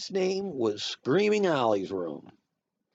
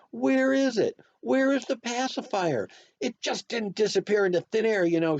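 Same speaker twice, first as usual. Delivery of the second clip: high, loud, frantic mother; a mother hollering for something lost; hollered very loud; 11 semitones higher, 4 dB louder than usual. A narrator's reading. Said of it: "she hollered very loud".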